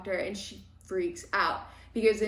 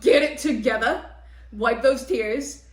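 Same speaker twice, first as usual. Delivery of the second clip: commanding; 2 semitones higher, 7 dB louder than usual. Retold or imitated. Heroine commands crying girl.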